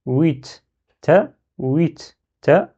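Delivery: "Huit" closed on t, pause, then "ta"; split syllables. Shown as a demonstration. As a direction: break it in two, "Huit / ta". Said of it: "il y a deux syllabes".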